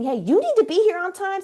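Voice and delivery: scolding parent. Shown as a demonstration. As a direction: nag like a frustrated parent. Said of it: "Instead of saying".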